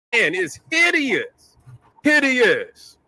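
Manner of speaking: slow, high drawn-out hideous; appalled, lingering emphasis; disgusted. Real, not acted. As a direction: drag the word out in disgust. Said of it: "Real fucking talk".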